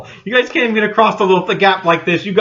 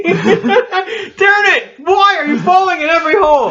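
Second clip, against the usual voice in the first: laughing shout; 12 semitones higher, 3 dB louder, slower than usual. Real, not acted. Character keeps falling.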